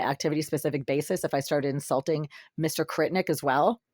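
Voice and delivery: ordinary talk, nothing marked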